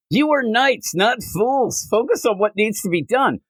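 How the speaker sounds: high mock commander voice; knight leader. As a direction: bark orders in a lightly hammed-up hero tone. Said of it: "the one guy goes".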